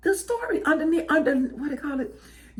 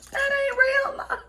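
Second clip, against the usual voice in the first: very high, held-out exclamation; hammed-up high protest; high-pitched verdict; 14 semitones higher than usual. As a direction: cap the rant in a mock high wail. Capping her rant on transactional love.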